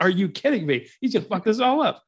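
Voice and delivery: high-pitched mock exasperation; annoyed cops. Cops reacting to Batman showing up, annoyed. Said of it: "how immediately annoyed the cops are".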